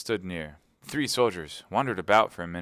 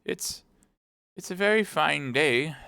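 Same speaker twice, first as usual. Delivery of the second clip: high, hesitant little voice; timid; 8 semitones higher than usual. Acted. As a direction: falter at the start, speak up high. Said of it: "said the timid voice".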